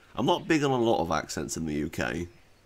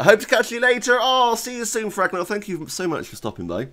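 high-pitched caricature, easing at the end; loud, grating send-off; Beth; 13 semitones higher, 5 dB louder than usual. Acted. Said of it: "Beth's voice, oh God".

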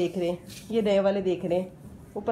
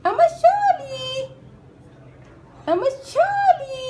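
put-on very high, slow baby-talk sorry; baby voice apology; 6 dB louder, much slower than usual. Speaking for Ahmad to herself, high slow baby voice. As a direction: coo it like a tiny child saying sorry.

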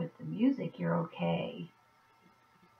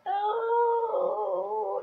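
mock sigh; 12 semitones higher than usual. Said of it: "you're kind of there going".

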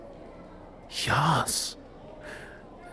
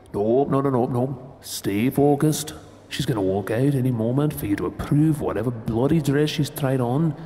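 usual speaking voice

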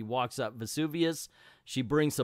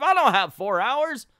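frantic; 10 semitones higher, 5 dB louder than usual. Retold or imitated. Scrooge panicking.

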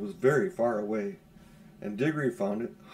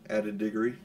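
plain reading voice

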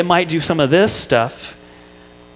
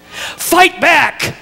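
mom's shout; 12 semitones higher than usual. Retold or imitated. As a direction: bark it back like a fed-up mom. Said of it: "I'd hear from the kitchen".

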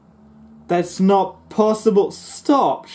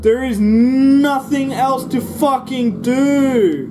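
slow, drawn-out angry yell, higher and louder; frustrated yell; 6 semitones higher, 4 dB louder, slower than usual. Real, not acted. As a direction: drag every word out in fury. Raging at the game.

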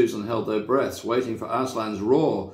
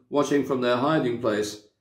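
ordinary delivery, nothing marked

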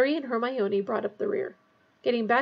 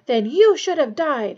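loud, stretched-out angry roar; 4 semitones higher, 9 dB louder than usual. A narrator's reading. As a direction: roar it slowly at the traitor.

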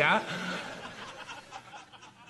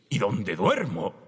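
imitating the nervous man; 7 semitones lower, slower than usual. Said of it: "Y dices".

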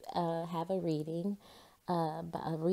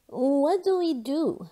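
put-on higher, louder, slow anxious boy; worried Jasper; 8 semitones higher, 5 dB louder, slower than usual. A narrator's reading. Jasper asking Margaret, higher and pleading. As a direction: plead for help, pitched up. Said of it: "asked Jasper".

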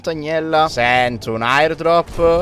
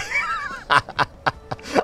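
high squeal of laughter; 5 dB quieter than usual. Real, not acted. Laughing burst.

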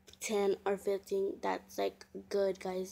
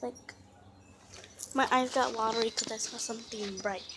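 ordinary talk

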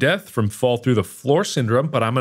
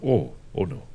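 normal talking voice

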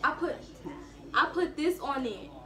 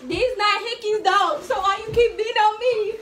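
high, raised-voice complaint; indignant, pitched-up outcry; loud protest; 6 semitones higher, 6 dB louder than usual. Real, not acted. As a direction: protest loudly while taking hits. Protesting while being beaten.

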